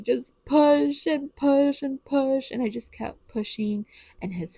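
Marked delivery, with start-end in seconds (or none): 0.0-2.5 higher, slow, drawn-out pushes; straining self-encouragement. Retold or imitated. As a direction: stretch each push like real effort. Replays her pep talk while pushing.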